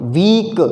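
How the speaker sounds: वीक drawn out on its long ई, ending in a clear क; slow वीक with a held vowel; blended sounds demo. Shown as a demonstration. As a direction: stretch वीक so each sound shows. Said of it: "दूसरा ई sound हो गया और का sound हो गया".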